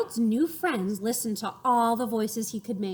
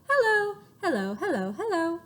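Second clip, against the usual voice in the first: much higher squeaky hellos; 7 semitones higher than usual. Shown as a demonstration. Showing kids another way to say hello. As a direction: pitch the hellos way up. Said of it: "say hello three different ways".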